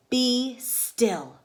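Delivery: commanding Max. Acted. Max commanding the monsters, firm. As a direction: make them freeze. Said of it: "Till Max said".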